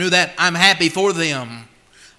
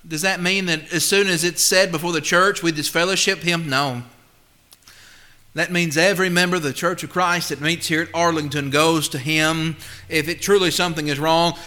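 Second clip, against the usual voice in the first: normal talking voice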